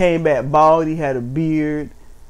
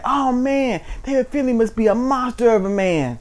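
mock awed listener; 8 semitones higher than usual. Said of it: "be like".

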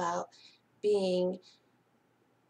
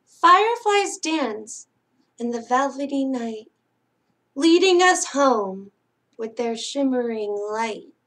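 louder, lilting read-aloud for little ones; singsong picture-book reading; bedtime story reading; 2 semitones higher, 6 dB louder than usual. Acted. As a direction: read it like a lullaby to sleepy kids.

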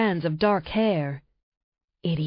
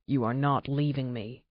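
low, commanding growl-like order, lower voice; predator deep; 7 semitones lower, 7 dB quieter than usual. Acted. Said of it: "his voice predator deep".

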